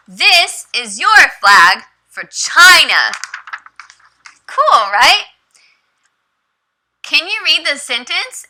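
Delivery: high, slow, bright classroom talk with a high cheer; teacher voice. Shown as a demonstration. Teacher shows her young student the flags, high and slow. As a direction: model the sentences slowly for a child.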